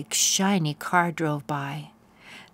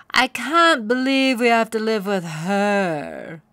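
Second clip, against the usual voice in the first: louder, sneering pony complaint; glaring, scornful; 3 semitones higher, 7 dB louder than usual. A narrator's reading. Pony glaring at Poniella, scornful and loud. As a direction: spit out the disgust at Poniella. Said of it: "Bunbun said, glaring".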